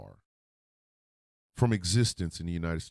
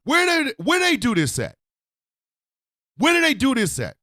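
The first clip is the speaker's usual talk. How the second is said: furious rant; 9 dB louder than usual. Real, not acted. A comic raging at a general's erasure with high furious yelling. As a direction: spit out the alliterative insults in full outrage. Repeating the outraged question.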